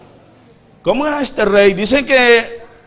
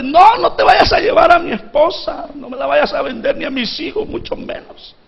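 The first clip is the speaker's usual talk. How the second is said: high, breaking plea that drops to a whisper and back; a ruined servant begging his king for time; desperate debtor pleading; 6 semitones higher than usual. Acted. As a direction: beg the king not to take your wife and children. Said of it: "empiece a ver a este hombre, hermano, diciéndole".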